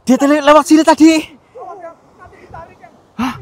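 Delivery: fast, high, loud "dia tadi lewat sini"; panicked shout. Real, not acted. Explorer telling his friend the creature just passed by, panicked. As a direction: blurt it out in a panic.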